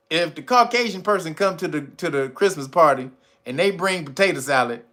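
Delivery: normal talking voice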